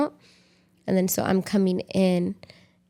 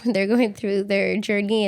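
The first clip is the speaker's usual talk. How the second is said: ordinary delivery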